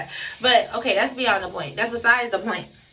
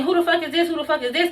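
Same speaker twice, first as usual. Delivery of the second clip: higher-pitched indignant question; retold outrage; 6 semitones higher, faster than usual. Correcting herself on who knocked, retold outrage. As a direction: replay the outraged demand, pitch raised, not shouted. Acted outrage, repeated for viewers.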